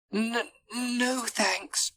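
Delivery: small hesitant voice; nervous refusal. A narrator's reading. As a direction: decline timidly, tripping on the first word. Reluctant Edward declines the trip.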